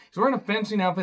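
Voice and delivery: exasperated "He's wearing a fencing outfit"; disbelieving repeat. Real, not acted. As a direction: repeat it like you can't believe it.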